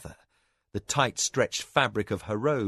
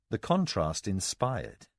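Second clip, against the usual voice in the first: plain reading voice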